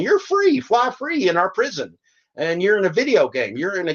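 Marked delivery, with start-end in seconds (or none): 0.0-2.0 high mocking voice; sing-song fake freedom pitch, much higher; mocking the app makers. Mocking the gig companies to drivers, high mock sweetness. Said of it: "They're going".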